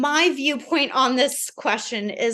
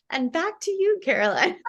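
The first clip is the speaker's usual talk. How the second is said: high, playful mock newscaster sign-off; 7 semitones higher than usual. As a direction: toss it back like a TV anchor. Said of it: "You're such a newscaster. You're like".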